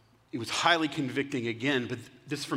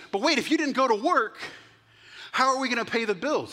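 high, louder, alarmed questioning; exasperated wife; 8 semitones higher, 4 dB louder, faster than usual. Acted. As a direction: demand an answer from a useless husband. Wife confronts husband who skipped work.

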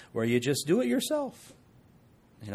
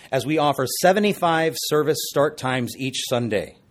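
loud, fast pitchman energy; infomercial voice; 6 dB louder than usual. Acted. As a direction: sell it like late-night TV. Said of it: "I sound like an infomercial".

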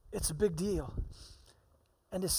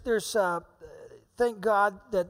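usual speaking voice

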